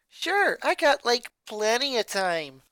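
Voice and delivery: put-on breezy enthusiastic teen. A narrator's reading. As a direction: answer eagerly and sunny. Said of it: "Lenny replied enthusiastically".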